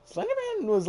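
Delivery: name jumps up high and loud, then falls; mock-scared high squeak; sudden squeak. Back to talking, name squeaked high.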